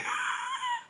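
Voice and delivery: shrieking laugh; high squeal of laughter. Real, not acted. Laughing at the memory.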